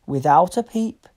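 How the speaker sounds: plain reading voice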